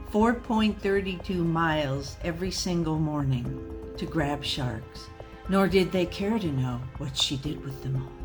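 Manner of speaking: ordinary delivery, nothing marked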